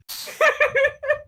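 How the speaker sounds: very high delighted laugh; high burst of laughter. Real, not acted. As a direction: break into laughter at the punchline. Laughing while retelling the joke.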